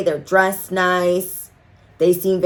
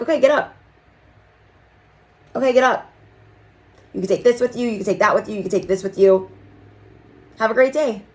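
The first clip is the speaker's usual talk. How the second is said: higher, sugary-sharp send-off; mock-polite eviction of rude customers, pitched higher; sassy dismissal; 4 semitones higher, faster than usual. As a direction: shoo them out with fake politeness.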